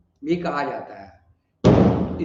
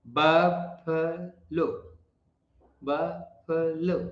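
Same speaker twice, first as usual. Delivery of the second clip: Buffalo very slowly as "buf, fuh, lo"; syllable by syllable; much slower than usual. Shown as a demonstration. Teacher corrects learners' buffalo, slow separated syllables. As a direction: separate buffalo into drawn-out parts, weak "fuh" middle. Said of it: "फे नहीं हुआ".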